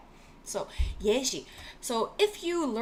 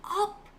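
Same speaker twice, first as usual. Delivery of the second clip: up leaps much higher in pitch; stress on up; 10 semitones higher than usual. Shown as a demonstration. Teacher stressing up while explaining linking. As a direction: lift the word up high to show the move. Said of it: "is moved".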